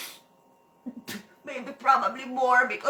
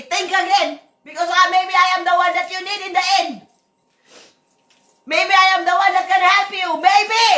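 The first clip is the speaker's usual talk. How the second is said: raised, loud and heated lecture; angry rant; 5 semitones higher, 7 dB louder than usual. Real, not acted. Boss scolding her people online, loud and angry. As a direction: scold them loudly, a higher pitch throughout.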